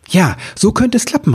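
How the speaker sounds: high, sudden burst of hope; excited call. A narrator's reading. Wichtel finds a way to save Christmas, calling out excitedly. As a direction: call out as the idea strikes. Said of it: "rief Ribbelwitsch".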